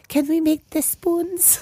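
high, slow, little-girl mock voice; Fabian. Girlfriend in bed asks for cuddling, high slow mock voice. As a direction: draw it out in a high put-on accent. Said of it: "Fabian's laying there".

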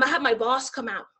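ordinary delivery

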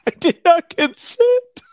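giggling through a high mock quote; laughing mock quote. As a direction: laugh while parroting the victim. Repeating abductee's line through laughter.

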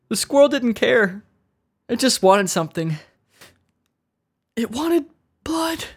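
high-pitched, hammed-up traumatized witness; distraught squirrel victim. Squirrel attack victim recalling the trauma, hammed-up distraught. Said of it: "let me get into character here".

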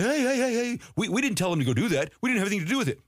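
high, hurried mock protest; mock defensive NIH. NIH protesting innocence, mocked by the host. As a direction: whine the denial like a caught official. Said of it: "N I H came out and said".

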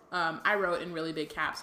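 ordinary talk, nothing marked

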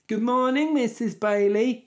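high, loud, drawn-out classroom greeting; schoolchildren greeting teacher. Acted. Pupils greet their teacher Missus Bailey, mock schoolchild chorus. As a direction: chant it up high like a dutiful class.

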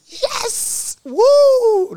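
very high, very loud whoop of joy; ecstatic scream. Retold or imitated. Patient hears he has TB not cancer, ecstatic high whooping scream. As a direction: shriek it like you won the lottery. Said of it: "did I not s-, scream".

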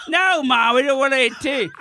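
annoyed son yelling back. Retold or imitated. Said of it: "He's like".

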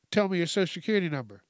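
fast, hurried mock demand; acted rushed questioning; rushing. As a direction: rattle it off like an impatient caller. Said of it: "and then you're rushing me".

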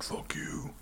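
whispered comeback under the breath; whispered retort. Retold or imitated. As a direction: hiss it back quietly. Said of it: "And you're like".

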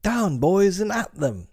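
high, ringing battle cry; rally the boys to charge; Peter. A narrator's reading. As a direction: clear high-pitched call to attack. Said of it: "Peter's voice rang out".

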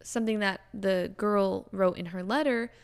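normal talking voice

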